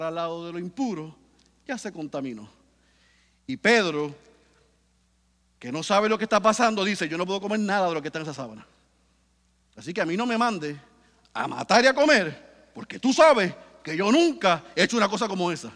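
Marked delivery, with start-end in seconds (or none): 3.5-7.1 loud fervent preaching, voice driving upward; preaching at full force. Acted. 7.1-15.7 fast, loud, agitated protest; Peter refusing. Acted.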